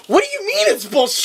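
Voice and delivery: high-pitched mock outrage; indignant, hammed-up retort; freaking out. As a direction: imitate someone flipping out, pitch shooting up. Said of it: "She starts freaking out on him".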